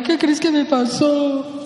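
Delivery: slow, drawn-out, self-pitying question; a hammed-up tearful plea to a partner; mock whimper. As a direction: play the victim fishing for comfort. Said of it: "Cuando llegas llorando con tu pareja".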